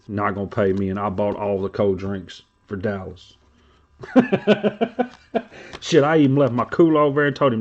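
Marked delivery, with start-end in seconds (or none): 4.0-5.1 sudden high cackle; high burst of laughter. Real, not acted. Streamer laughing at his own gripe, high cackle. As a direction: laugh out at the joke.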